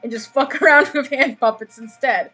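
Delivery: words breaking into laughter; laughing. Real, not acted. GM laughing through the payoff.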